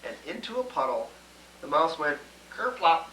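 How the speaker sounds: ordinary delivery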